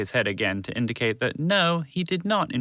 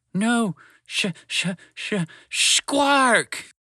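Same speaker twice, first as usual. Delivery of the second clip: panicked stammer; 10 semitones higher, much slower than usual. A narrator's reading. Spaceship spotting a squark behind Trevor, panicked. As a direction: fight for the word, then yell it.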